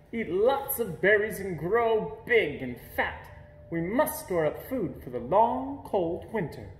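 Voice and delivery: gentle hint of a big mother bear urging her cub.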